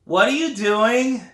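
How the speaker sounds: put-on high, slow kid's nagging question; little brother voice. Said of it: "Brian ... and says".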